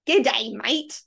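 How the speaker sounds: outsider's imitation Australian, each word separate; put-on Australian, carefully spaced words; mock Australian accent. Shown as a demonstration. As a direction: overdo a tourist's Australian greeting. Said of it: "if someone's saying an Australian version".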